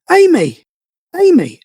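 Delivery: put-on high, loud little boy calling a name; a frightened child pointing at the door; imitating his son. Dad retelling his young son's call: high, loud child's voice. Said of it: "he's gone".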